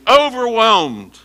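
Overwhelmed drawn out high and loud; slow emphasis. Real, not acted. Preacher explaining the verse, slow high Overwhelmed. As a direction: stretch Overwhelmed slowly for effect. Preacher stretches key word.

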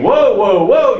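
amazed whoa. Retold or imitated. Preacher echoing the crowds' astonished cry, excited. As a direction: voice the awestruck crowds calling out. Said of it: "three groups last week saying".

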